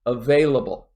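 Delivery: "available" with weight on "vail"; second-syllable stress. Shown as a demonstration. Teacher drilling a long word, second syllable stressed. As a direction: hit the second syllable of "available", then fall away. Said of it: "I also stress the second syllable".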